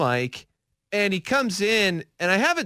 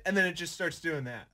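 quieter, off mic; far from mic; 8 dB quieter than usual. Real, not acted. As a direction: sound away from the microphone. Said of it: "talk around it like this".

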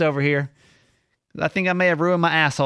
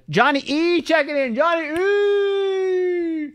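higher, stretched-out name call; higher hype shout of a viewer's name; 13 semitones higher, slower than usual. Real, not acted.

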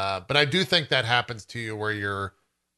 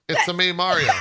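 lively video-game catchphrase, a bit higher; Mario; 4 semitones higher, 3 dB louder than usual. Acted. Joking about a movie casting, a lightly put-on Mario voice. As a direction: attempt a cartoon plumber's catchphrase. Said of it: "That's not the voice".

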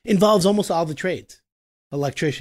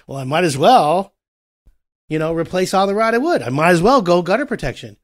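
impulsive homeowner; 3 semitones higher, 7 dB louder than usual. Retold or imitated. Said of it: "and you're just like".

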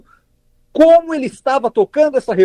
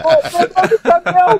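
laughing exclamation; 8 semitones higher than usual. Pretend. Mock-marvelling at the clip.

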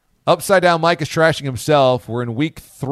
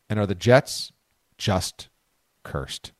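ominous; 6 semitones lower, 3 dB quieter than usual. Acted. Ominous poll question.

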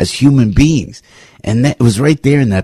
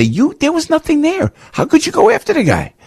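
high squeaky mocking voice of a lawyer, much higher. Host mocking the lawyers who prosecuted Trump, high mock voice. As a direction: mimic the lawyers scolding in a high mocking pitch.

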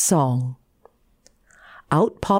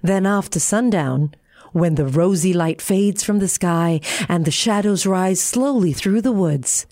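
plain reading voice

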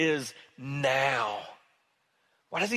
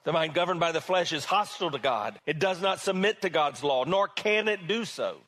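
usual speaking voice

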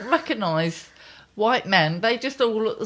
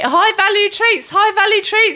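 mock frantic coaxing; 10 semitones higher, 4 dB louder than usual. Coaxing runaway dogs with treats.

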